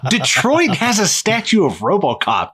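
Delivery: mock outrage. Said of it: "And I was like".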